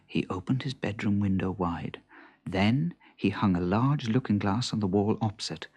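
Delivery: ordinary delivery, nothing marked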